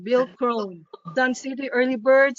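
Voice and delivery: ordinary talk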